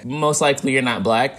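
normal talking voice

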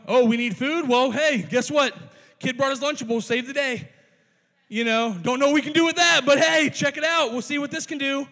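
loud, sarcastic mock enthusiasm; sarcastic Andrew. Andrew scoffing loudly at the boy's lunch. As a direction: sneer it out like an exasperated disciple. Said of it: "I'm picking up a little sarcasm".

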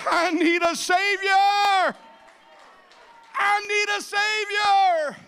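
pleading shout drawn out word by word; desperate cry. Acted. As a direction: cry out for a Savior at the top of your voice. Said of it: "cry out".

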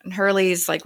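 ordinary delivery

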